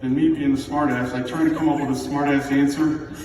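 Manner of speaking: ordinary talk, nothing marked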